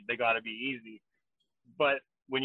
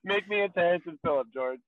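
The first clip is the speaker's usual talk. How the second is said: excited; 6 semitones higher, 4 dB louder than usual. Real, not acted. Excited request.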